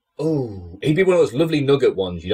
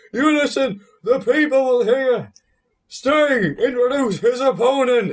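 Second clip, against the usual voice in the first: much higher, slow, stumbling mock promo voice; Bulldog impression; slower than usual. Mocking Bulldog talking over Sting, slow high stumbling imitation. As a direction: deliver it like a wrestler who can't get the words out. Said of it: "Like, he just couldn't talk".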